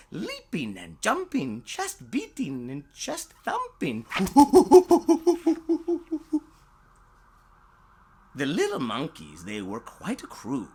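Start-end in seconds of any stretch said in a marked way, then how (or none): 4.1-6.4 loud high cackling laugh; shrieking laugh. Pretend. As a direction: burst into a big high laugh. Laugh in story.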